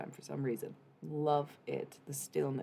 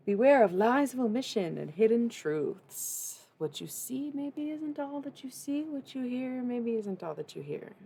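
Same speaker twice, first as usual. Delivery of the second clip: dramatic reading; 8 semitones higher, 7 dB louder than usual. Acted. Reads a tarot-style message.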